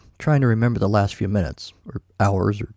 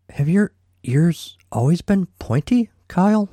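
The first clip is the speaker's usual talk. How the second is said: dazed wonder; 7 semitones higher than usual. Acted. Dazed Milo questions glittery Kyle.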